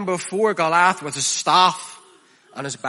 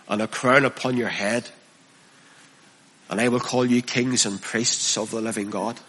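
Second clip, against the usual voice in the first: Jesus's gentle call; 5 semitones lower than usual. Acted. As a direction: draw them in gently, like a shepherd. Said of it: "But Jesus says".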